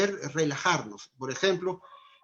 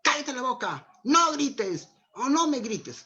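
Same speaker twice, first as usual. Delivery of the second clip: higher mock snap at a child; impatient parent yelling back; a parent's angry scolding; 7 semitones higher than usual. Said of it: "¿Qué les dice?".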